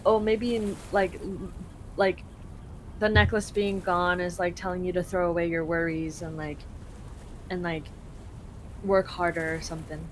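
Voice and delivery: ordinary talk, nothing marked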